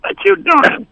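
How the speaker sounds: high questioning falsetto; mock female falsetto. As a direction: ask in a put-on woman's high voice. Voices woman in retold dialogue.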